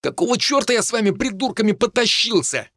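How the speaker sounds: high-pitched exasperated rant; indignant high tirade; angry bark. A narrator's reading. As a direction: rail at companions, pitched well up. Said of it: "рявкнул Хинниган".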